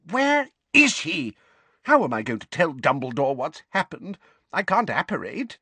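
put-on high, fretful old lady voice; flustered old woman. A narrator's reading. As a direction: fret aloud like a panicked neighbour. Figg fretting.

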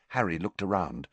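ordinary delivery, nothing marked

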